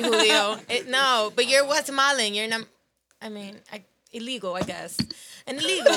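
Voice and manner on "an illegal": blurted out through a laugh; laughing at his own joke; giggling punchline. Real, not acted. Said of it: "Oh, he's illegal. laughs".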